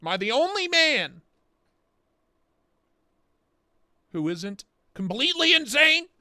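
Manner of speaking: rising to a frantic high shout; ranting movie quote. Retold or imitated. Quoting the bowling movie line in a mounting shout. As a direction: yell it like a man at the end of his rope. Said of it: "Whatever the line is from".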